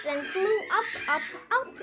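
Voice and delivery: giggling through the words; laughing. Real, not acted. Reading to a child, laughing as the balloon climbs.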